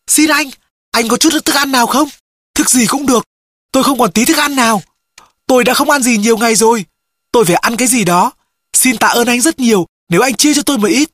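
high-pitched, urgent plea; begging. A narrator's reading. Starving stranger asks the castaway for food, high pleading voice. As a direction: beg desperately for a bite.

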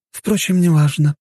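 high female voice, brushing it off; dismissive woman. A narrator's reading. Woman dropping her question about weapons, dismissive. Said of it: "махнула рукой женщина".

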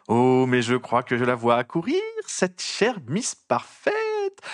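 a syrupy nosy reporter savouring every word; trumpeting gossip journalist. A narrator's reading. Rita Skeeter taunts Harry in front of the mourners, high and mocking.

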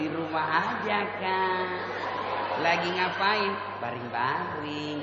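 a soft, drawn-out coo; lightly voiced girl lingering on the phone; mock swooning girl. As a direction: drag the words out dreamily. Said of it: "jangan kau mendayu-dayu".

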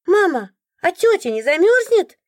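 put-on high, loud little kid's question; high-pitched child's voice; curious child. A narrator's reading. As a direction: pipe up like a small boy asking mum. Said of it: "задал резонный вопрос ребёнок".

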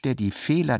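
ordinary talk, nothing marked

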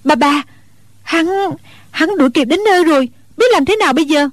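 put-on high rushed girl's voice, scared; panicked girl. A narrator's reading. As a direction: blurt it out in a panic as the pursuer closes in. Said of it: "Cô nương kia hoảng hốt gọi".

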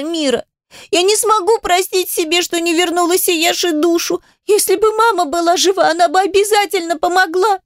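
high, crying plea; tearful pleading. Acted. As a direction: beg through tears. Adel pleading in tears.

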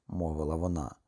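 usual speaking voice

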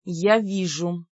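медленно, сдержанно-гневно; процеди слова, сдерживая злость; процедить с гневом. A narrator's reading. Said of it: "процедила я, гневно раздувая ноздри". (сквозь зубы, гневно)

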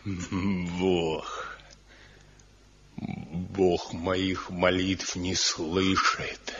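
a broken sob on the first word, then quiet and slow; crumbling under despair, barely getting it out; choked crying. Acted. Son to his father, losing faith, choked and quiet. Son breaks down, God abandoned.

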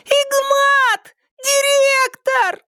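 панический высокий крик, слова тянутся; в панике звать на помощь; испуганный крик. A narrator's reading. Девчонки зовут директора в панике. (испуганно кричать)

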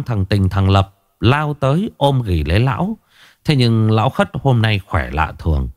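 ordinary delivery

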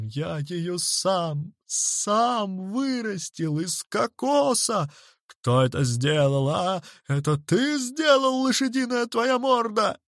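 slow howl climbing to high-pitched accusation; anguished yelling. A narrator's reading. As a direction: bawl in outrage at the culprit. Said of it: "заорал он благим матом". (орать благим матом)

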